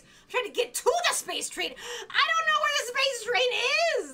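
rising, high complaint; exasperated. Real, not acted. Exasperated at being lost in game.

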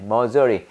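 misery turned into Korean "머저리"; misreading of misery. Retold or imitated. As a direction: say "머저리" as a joke reading of misery. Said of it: "머저리로 읽히죠".

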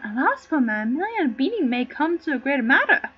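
normal talking voice

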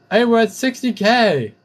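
high, loud cheer; excited shout. Real, not acted. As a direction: whoop it out in triumph. Celebrating a score.

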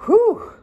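a high, loud relieved exhale; relieved whew. Real, not acted. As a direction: blow out a big whew of relief. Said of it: "that was nice".

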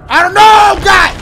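loud frantic yell; panicked shout. Real, not acted. Panicked in zombie fight.

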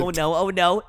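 ordinary talk

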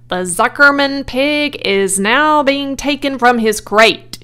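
grand fairground public announcement; booming loudspeaker. A narrator's reading. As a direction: boom it out to the crowd. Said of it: "boomed the voice of the loudspeaker".

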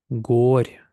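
"Горь" with a long held "о"; long vowel. Shown as a demonstration. Teacher models word for learners, "Горь" with long "о". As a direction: stretch the "о" in "Горь". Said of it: "Довга".

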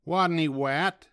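plain reading voice

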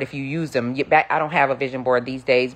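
ordinary talk

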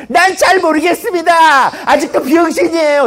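high, hammed-up proclamation; high-pitched mock pledge cry; mock shouted declaration. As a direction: proclaim it mockingly as if it were a dream. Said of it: "이렇게 외치란 말이야".

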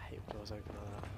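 hushed nervous whisper. Real, not acted. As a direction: whisper so nothing hears. Whispering while sneaking.

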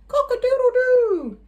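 high, loud rooster crow. Acted. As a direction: crow it like a proud cockerel. Cockerel crows at dawn.

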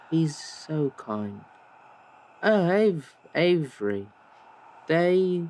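put-on high, dreamy, faraway voice of a disoriented young woman; dazed Bryn. Bryn, dazed after the fight, answering Avery in a high dreamy voice. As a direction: drift through the words as if half somewhere else.

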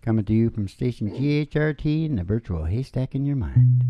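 laid-back DJ patter, sinking low; radio DJ intro. Acted. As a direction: mellow late-night station ident. Radio station announcer intro.

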